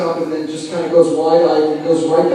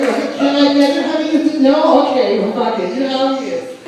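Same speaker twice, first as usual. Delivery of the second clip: higher, louder whiny pleading; whiny errand boy; 6 semitones higher, 4 dB louder than usual. Retold or imitated. Comic fetching drinks for Eddie, high whiny mock pleading. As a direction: beg for the drink like a lackey.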